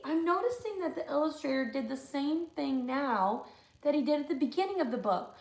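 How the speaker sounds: usual speaking voice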